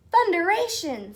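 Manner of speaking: bursting with dismay; exclamation. Acted. Tom amazed at bush field.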